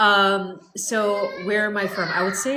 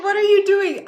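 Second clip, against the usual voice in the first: startled stranger; 12 semitones higher, 10 dB louder than usual. Retold or imitated. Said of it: "the person's like".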